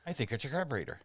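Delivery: a hint of a talking cow in a joke. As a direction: pitch it up a little for the cow. And this cow says.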